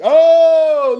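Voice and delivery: a sudden, loud, high oh of surprise; startled loud greeting; loud surprised oh. Real, not acted. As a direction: call it out as someone walks in. Someone walks into the room.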